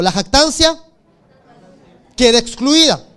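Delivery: loud, emphatic line for the congregation to repeat; call and response. Shown as a demonstration. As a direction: proclaim it so they echo. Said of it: "Diga conmigo". (proclamación fuerte para que la congregación repita)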